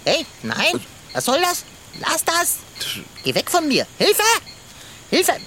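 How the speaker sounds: high, alarmed cries for help; panicked protest. Acted. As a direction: fend off the koala in a panic. Koala climbs on the cat. (panisch um Hilfe rufend)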